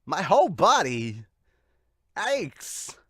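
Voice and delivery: rough, half-hearted celebrity impression; crappy Chris Walken. Reader voices an aching character, crappy Walken impression. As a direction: do a poor celebrity impression with a loud, swooping start. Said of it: "crappy Chris Walken".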